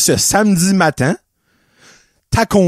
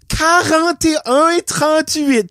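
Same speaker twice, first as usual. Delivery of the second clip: Quarante et un trente-huit drawn out slowly and higher, mock teacher; condescending mock; 8 semitones higher, slower than usual. Old lady repeating the price to the clerk, slow condescending mock. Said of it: "t'aurais probablement ri de elle comme".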